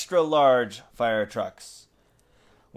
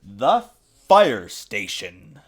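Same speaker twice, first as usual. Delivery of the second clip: title read; 6 dB louder, much slower than usual. A narrator's reading. Reading the title aloud.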